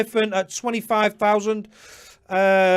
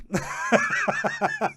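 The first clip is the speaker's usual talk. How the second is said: burst of laughter; 4 dB quieter than usual. Real, not acted. Laughs after apologising to mate.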